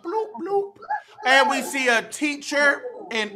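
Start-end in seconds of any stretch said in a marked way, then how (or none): 0.0-0.8 fish bubbles. Acted. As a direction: blow little fish bubbles. Animal sounds in picture book.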